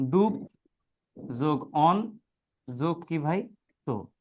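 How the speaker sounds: ডুব, অন, তো each said alone with long pauses; teacher's piece-by-piece sounding out of ডুব, অন, তো; broken into syllables. Shown as a demonstration. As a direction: split ডুবন্ত into ডুব plus অন plus তো, one beat at a time. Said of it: "আমি ভেঙে ভেঙে উচ্চারণ করলে".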